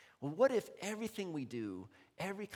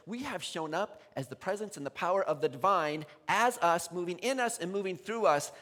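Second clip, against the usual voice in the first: ordinary talk, nothing marked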